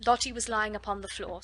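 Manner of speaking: usual speaking voice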